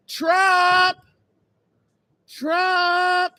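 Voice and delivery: high, loud call across the room; shouting for Trump. Pretend. Calling out to summon Trump, high loud shout. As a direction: holler his name to summon him.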